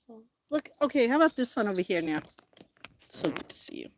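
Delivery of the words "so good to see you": breathy whisper hello. Real, not acted. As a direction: whisper a soft greeting. Greeting viewers softly.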